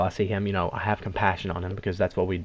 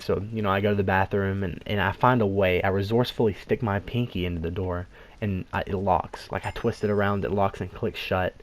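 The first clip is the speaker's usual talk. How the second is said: usual speaking voice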